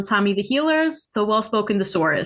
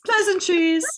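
mock posh; 11 semitones higher, slower than usual. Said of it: "echoes mock greeting".

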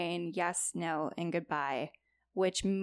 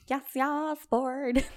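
goofy voice; 5 semitones higher, slower than usual. Pretend. Playful goofy voice.

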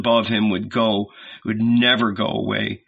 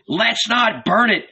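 high, insistent objection; objecting; 11 semitones higher than usual. A narrator's reading. Others in the mob object to burning, insistent. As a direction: object from within the crowd, pitched up. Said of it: "others objected".

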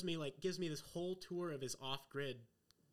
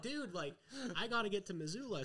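mock urgent plea; 8 semitones higher than usual. Urging driver to hit road.

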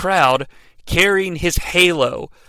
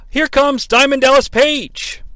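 announcer call; 10 semitones higher than usual. Pretend. Announcer-style entrance call.

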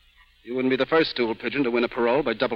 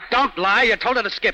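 higher and louder, a hard accusing snap; angry accusation; 9 semitones higher, 5 dB louder than usual. Acted. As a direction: pin her down with the lie. Gangster accuses Goldie of betrayal.

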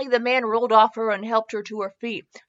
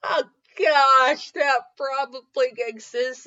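laughing aside; 4 semitones higher, slower than usual. Real, not acted.

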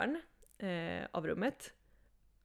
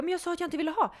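upset protest; 9 semitones higher, 5 dB louder, faster than usual. Retold or imitated. Protesting to man who spiked drink.

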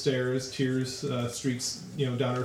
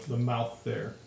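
usual speaking voice

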